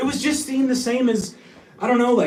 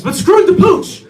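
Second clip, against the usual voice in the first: shouted; 8 semitones higher, 8 dB louder than usual. Acted. Punchline shout.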